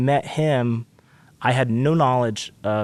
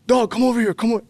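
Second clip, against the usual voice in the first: eager call; 10 semitones higher than usual. Retold or imitated. Ian calling him over.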